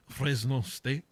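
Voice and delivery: put-on lightly French-accented Fresno State; a half-hearted French accent on the school name. Host tries a mild French accent on his school's name. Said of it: "Is that my French, uh, accent?".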